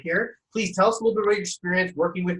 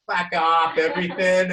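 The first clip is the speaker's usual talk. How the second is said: mock Australian slang voice; imitated Australian accent; 3 semitones higher, 3 dB louder than usual. Host mimicking his Australian members, mock Australian accent. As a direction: put on a light Aussie accent. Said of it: "I'm addicted to their accent".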